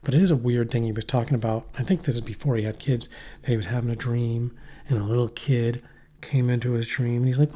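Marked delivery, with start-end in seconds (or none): none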